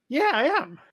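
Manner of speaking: very high, loud little goblin; boastful goblin. Acted. Goblin agrees he is mighty leader, high and boastful. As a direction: puff up proudly in a high goblin voice. Flattered goblin answers hobgoblin.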